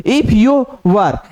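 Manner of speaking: drawn out, word by word; slow dictation. Shown as a demonstration. Teacher dictates the phrase slowly to the class. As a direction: read it out slowly for students to write. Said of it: "teacher dictating a shorthand phrase".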